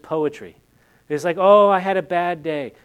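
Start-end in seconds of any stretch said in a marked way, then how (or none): 1.4-2.7 loud, higher-pitched mock whine; exaggerated moan over a trivial trouble; mock complaint. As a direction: blurt out a hammed-up petty grievance.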